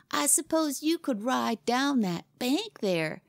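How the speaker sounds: high, slow, sly challenge; artful. A narrator's reading. Boy lures his rival toward the steep bank, artful. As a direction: lay the bait with drawn-out false innocence. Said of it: "he asked with art".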